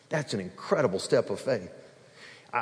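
ordinary delivery

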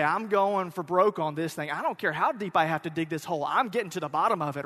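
loud, fast, stubborn insistence; doubling down. Acted. Angry husband refusing to back off an argument, doubling down loudly. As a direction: dig in with a rapid, forceful rant. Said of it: "you can double down".

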